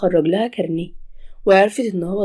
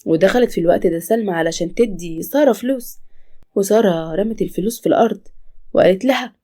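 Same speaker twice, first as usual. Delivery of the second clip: usual speaking voice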